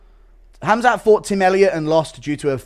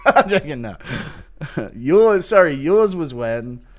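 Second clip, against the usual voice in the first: normal talking voice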